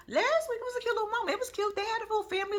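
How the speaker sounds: "Last week, it was a cute little moment" up high and sugary; cooing over a cute moment. Acted. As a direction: gush it in a high girly voice. Repeats line in high voice.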